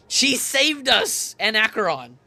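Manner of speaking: loud, high, slow insisting; indignant insistence. Real, not acted. To the characters on screen, insisting loud and slow. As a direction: drag it out in disbelief.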